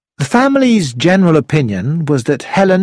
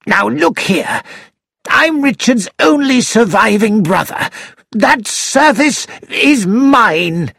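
high, slow indignant protest; 8 semitones higher, slower than usual. A narrator's reading. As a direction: insist hotly on your claim. Timothy purple, claiming the service.

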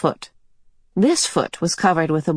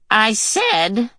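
a grunt of effort on the words; 5 semitones higher than usual. Acted. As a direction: strain as if pulling on a boot. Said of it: "she grunted".

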